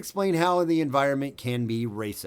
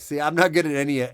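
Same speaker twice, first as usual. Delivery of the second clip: ordinary talk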